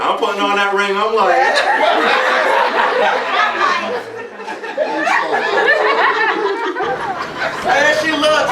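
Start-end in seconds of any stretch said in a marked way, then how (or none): 1.6-6.4 high burst of laughter. Real, not acted. Laughing at her reaction.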